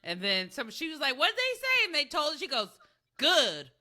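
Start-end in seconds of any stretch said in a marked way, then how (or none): 1.2-1.8 eager, high-pitched imitation; high-pitched retold question. Acting out her question. 3.2-3.7 mimicked one-word verdict, pitch falling away; imitating her "good". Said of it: "the way she said it".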